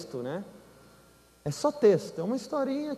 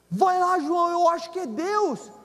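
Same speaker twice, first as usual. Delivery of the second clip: high pleading urge; esposa insistente; 8 semitones higher than usual. Retold or imitated. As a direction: push him, voice up. Wife urging again. (esposa insistente)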